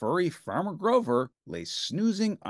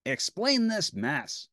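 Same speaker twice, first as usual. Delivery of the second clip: Bert voice; 6 semitones higher, slower than usual. A narrator's reading.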